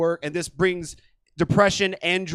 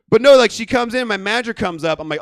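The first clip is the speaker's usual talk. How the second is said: normal talking voice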